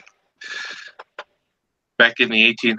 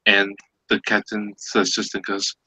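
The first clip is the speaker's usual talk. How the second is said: ordinary talk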